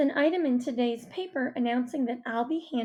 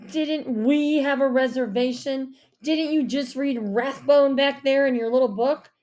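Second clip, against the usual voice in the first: louder, indignant questioning; angry; 3 semitones higher, 6 dB louder than usual. A narrator's reading. Dad challenging the host over the reservation, angrily. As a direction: demand an answer, offended. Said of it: "My dad turned dark red".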